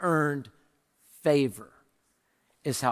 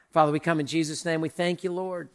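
closing prayer. Acted. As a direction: pray gently over the worship music. Closing prayer over music.

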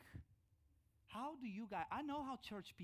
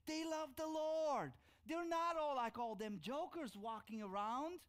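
loud, high, slowed preaching burst; emphatic praise; 9 semitones higher, 7 dB louder than usual. Real, not acted. Preacher praising his seminary professors, loud and emphatic. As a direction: proclaim it with fire from the pulpit.

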